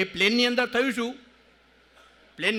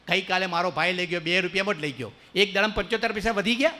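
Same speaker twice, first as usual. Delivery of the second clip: ordinary talk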